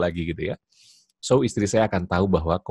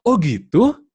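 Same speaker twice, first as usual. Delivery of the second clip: high, louder "oh, is that so?"; mock-interested questioning husband; responsive husband; 10 semitones higher, 6 dB louder, much slower than usual. Shown as a demonstration. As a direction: react with exaggerated interest. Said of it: "Yang kedua".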